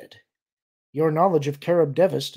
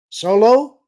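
loud hail, rising at the end; raised, questioning call; 9 semitones higher, 12 dB louder than usual. A narrator's reading. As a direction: call out to check who's there. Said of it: "a male voice called".

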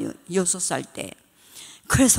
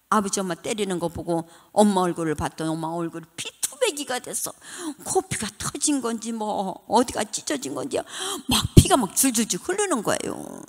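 normal talking voice

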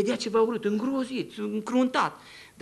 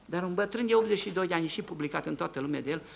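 ordinary delivery

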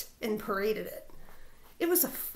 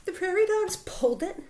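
ordinary delivery, nothing marked

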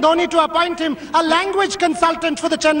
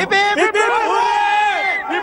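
cheer; 3 semitones higher, much slower than usual. Acted. Leading fans in a cheer.

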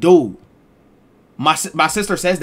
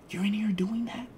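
hushed, much softer accusing whisper; mom whispering; 7 semitones higher, 10 dB quieter than usual. Retold or imitated. Mother catching her kids with liquor, hushed accusing whisper. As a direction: whisper it like a mom who just found the bottle.

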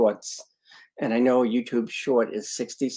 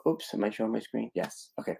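whispered aside; 7 dB quieter, faster than usual. Real, not acted. Checking screen share, aside to self.

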